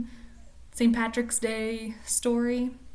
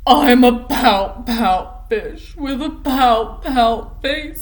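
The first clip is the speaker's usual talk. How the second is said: slow, louder, mopey singsong refrain; gloomy pout fish; 10 dB louder, slower than usual. Acted. Teacher reads the pout fish's gloomy refrain, slow and loud. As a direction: drag out every word like a sulking fish.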